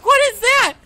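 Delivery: very high, loud cry of alarm; panicked shriek. Real, not acted. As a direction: shriek it in sudden fright. Sees something frightening in game.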